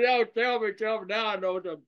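high, eager and pressing; eager excitement. Retold or imitated. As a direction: beg to hear the secret. Eager to hear the secret.

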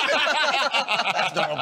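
laughing. Real, not acted. Laughing at the others.

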